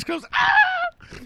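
high scream with eyes shut. Retold or imitated. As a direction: let out a sudden high scream. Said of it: "you were screaming with your eyes".

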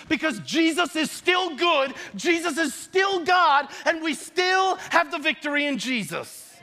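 high-pitched, emphatic preacher declaration; impassioned preaching. Real, not acted. Preacher proclaiming confidence in Jesus to the congregation, impassioned. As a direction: drive each phrase home with fervour.

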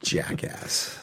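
whispered insult under breath; whispering. Real, not acted. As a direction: hiss it under your breath. Muttered insult after a rant.

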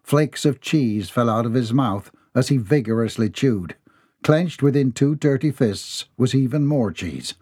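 plain reading voice

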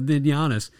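ordinary delivery

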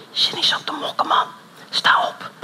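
soft unvoiced whisper of the Spirit. Holy Spirit whispering to the pastor, soft whisper. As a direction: whisper it like an inner voice. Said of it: "tot je fluistert".

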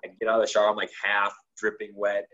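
usual speaking voice

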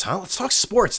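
ordinary delivery

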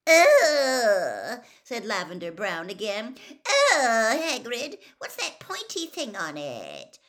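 high, loud, whimpering disgust; disgusted whine. A narrator's reading. Lavender recoils from the scroot's sting, whimpering. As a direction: recoil with a squeamish cry.

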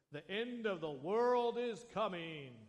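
slow, booming doomsday proclamation; doomsday prophet. Retold or imitated. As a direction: announce the end of the world like a doom prophet. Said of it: "Probably didn't say it like that".

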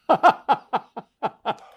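sudden loud burst of laughter. Real, not acted. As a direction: crack up out loud.

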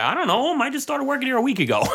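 very high, fast protest; retold protest to the angry bar, high and fast; incredulous. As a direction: squeak out your disbelief.

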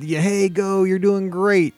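mildly hammed-up cheer for a runner. Spectator cheering a kid in a race, imitated cheering. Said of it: "get cheered on".

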